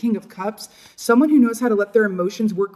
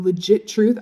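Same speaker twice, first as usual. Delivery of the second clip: ordinary talk, nothing marked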